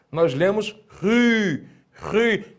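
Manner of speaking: ri said with a breathy r, slowly; aspirated rho. Shown as a demonstration. Teacher demonstrates the rough breathing on ri. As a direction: breathe through ri. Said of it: "apontada para a esquerda".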